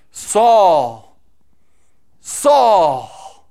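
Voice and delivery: loud, solemn call from above; calling voice from heaven. Acted. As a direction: call his name loud, like a voice from the sky. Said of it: "he hears him going".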